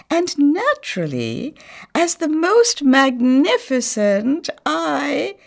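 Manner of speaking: high, slow, lofty boast; haughty grand lady. A narrator's reading. Proud Missus Millefleur boasting to the other houses, lofty. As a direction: preen like the grandest house on the street.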